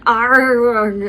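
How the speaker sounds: loud, drawn-out "arr" of anger; angry growl. A narrator's reading. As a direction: let out an exasperated roar. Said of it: "of anger".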